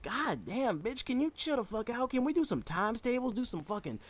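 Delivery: put-on high, strained kid's whine; exasperated squeal. Fifth grader begging the teacher to chill, high whine. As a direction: whine it up high like a fed-up kid. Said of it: "I'm like".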